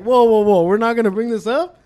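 retold hyped-up objection; loud protest. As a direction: call a halt like an amused student. Student calling out in disbelief.

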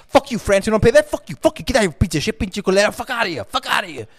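high, rapid-fire cussing-out in character; mock tirade. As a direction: play a hotheaded leader telling everyone off.

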